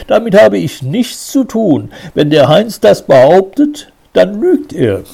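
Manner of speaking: high and loud, pushing back; vehement denial. A narrator's reading. Suspect pushing the blame onto Heinz, vehemently. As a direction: defend yourself hotly, voice up high. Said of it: "verteidigte er sich vehement".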